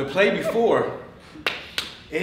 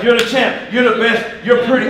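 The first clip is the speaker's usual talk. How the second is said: loud, high imitation hype man; raised, punchy cheerleading; hyping up; 6 semitones higher, 9 dB louder than usual. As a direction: pump up the champ loudly. Hype man telling Ali he's great.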